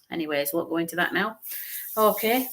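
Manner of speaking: usual speaking voice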